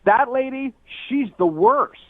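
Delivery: mock loud declaration, pitched high; a lightly mimicked loud outburst at the dinner table; retold loud voice. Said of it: "in a really loud voice".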